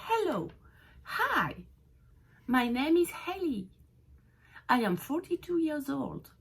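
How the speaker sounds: exaggerated English intonation demo; sing-song English. Shown as a demonstration. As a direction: model English greetings brightly. Teacher models English greetings.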